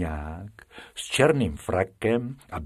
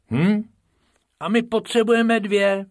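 higher, louder little rabbit working it out; Bobek, higher, puzzling over the fee; Bobek, puzzled; 12 semitones higher, 5 dB louder than usual. A narrator's reading. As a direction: count the coins with a worry. Bobek counting.

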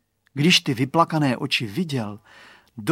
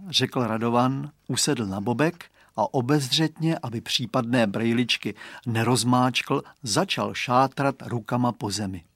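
ordinary delivery, nothing marked

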